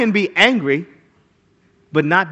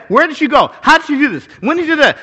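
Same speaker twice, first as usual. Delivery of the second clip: Judge Judy grilling; 8 semitones higher, 7 dB louder, faster than usual. Retold or imitated. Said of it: "She'll say".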